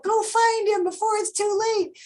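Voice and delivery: high-pitched urgent urging; retold yell at book. Reader yelling at the book to find him, urgent. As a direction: cry it out high, like time is running out. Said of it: "I'm yelling at the book".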